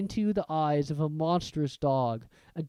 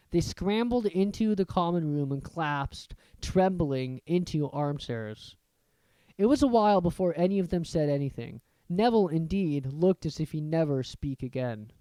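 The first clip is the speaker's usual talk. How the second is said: ordinary delivery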